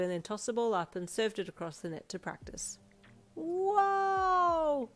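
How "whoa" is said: put-on high, drawn-out child whoa; amazed whoa. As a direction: gasp in awe like a kid. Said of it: "the twin boys chorused".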